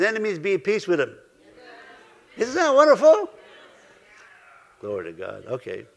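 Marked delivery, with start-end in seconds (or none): 2.4-3.3 high, loud, delighted question; excited exclamation. Real, not acted. Preacher exclaims to the congregation. 4.8-5.8 much lower and softer, murmured to himself; quiet aside. Real, not acted. Soft aside after the exclamation.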